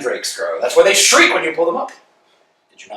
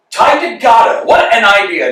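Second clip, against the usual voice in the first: loud, scoffing repetition; scornful outcry; 6 semitones higher, 10 dB louder than usual. Acted. Rejecting being tied to their man, a loud scoff. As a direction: throw the idea back, scoffing.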